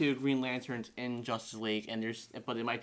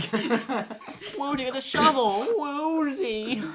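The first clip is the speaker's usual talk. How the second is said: much higher, loud goofy henchman; high cartoon voice; 12 semitones higher, 6 dB louder than usual. Plastic Man telling Woozie to fetch the shovel, high and loud. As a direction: squeak out orders like a cartoon crook. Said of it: "repeating the bit".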